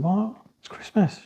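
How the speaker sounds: retold startled question, lightly acted; shocked. Doctor shocked that Bethel is alone at Christmas.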